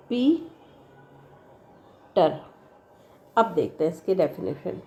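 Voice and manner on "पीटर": पीटर very slow, long gap between पी and टर; syllables far apart. Shown as a demonstration. Teacher showing how it divides, पीटर broken widely in two. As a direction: stretch पी, pause, then drop on टर. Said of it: "कैसे?".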